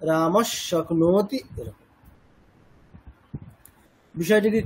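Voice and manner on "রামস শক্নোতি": slow রামস শক্নোতি with a long sh; joined pronunciation. Shown as a demonstration. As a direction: draw out রামস শক্নোতি as one unit. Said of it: "একসাথে বললে".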